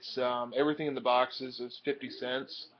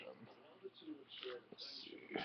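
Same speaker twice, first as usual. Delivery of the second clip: whispered under the breath to himself; just breathe it out while searching; soft whisper; 20 dB quieter than usual. Real, not acted. Muttering to self while sorting.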